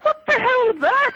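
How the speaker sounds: high slow astonished blurt; baffled exclaim. Retold or imitated. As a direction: react in disbelief. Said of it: "he's going".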